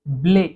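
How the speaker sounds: "ब्ले" said as French blé, ending on a clear closed é; French pronunciation demo. Shown as a demonstration. Teacher giving the French word for wheat, careful pronunciation. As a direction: model "ब्ले" with a crisp final é. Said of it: "तो यहाँ पर हो गया".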